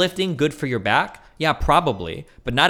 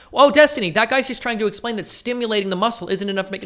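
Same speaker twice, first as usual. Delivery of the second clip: very high, loud whining voice; mock a whiny viewer; whiny chatter impression; 10 semitones higher, 4 dB louder than usual. Mocking a chatter correcting him, very high and whiny. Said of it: "mock chat voice".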